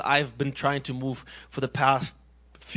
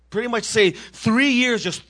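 normal talking voice

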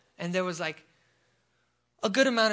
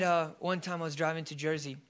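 ordinary delivery

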